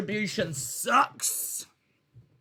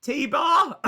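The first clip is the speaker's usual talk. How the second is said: loud, higher, panicked blurt; 8 semitones higher, 5 dB louder than usual. Pretend. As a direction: blurt it out against your will. Blurting the name out in the meeting.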